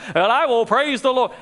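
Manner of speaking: high-pitched, emphatic declaration of praise; uplifted praise. Retold or imitated. Preacher voicing David swinging back and forth, in uplifted praise. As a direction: lift the voice into praise, as a psalmist.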